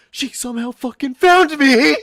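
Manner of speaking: high, loud outburst building to a scream; shrieked, incredulous climax; exasperated shout. Real, not acted. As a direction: yell it in disbelief. Outburst about stalker finding him.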